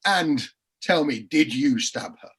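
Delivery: slow roundup question. Retold or imitated. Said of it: "he asks as the roundup question".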